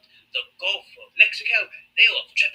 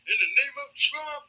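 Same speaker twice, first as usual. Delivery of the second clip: mock fan; 14 semitones higher, 5 dB quieter, slower than usual. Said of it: "it'd be like".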